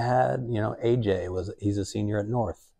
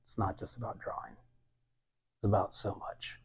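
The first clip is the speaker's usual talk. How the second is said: whispered inner thought; soft, awed whisper; hushed realization; 7 dB quieter than usual. Retold or imitated. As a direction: murmur it as a revelation.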